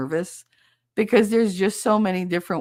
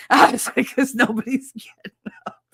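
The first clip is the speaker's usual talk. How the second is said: higher, breaking into laughter; laughing; 7 semitones higher, 5 dB louder than usual. Real, not acted. Caller joking about falls at home, laughing. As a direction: laugh through your own punchline. Laughs at her own joke.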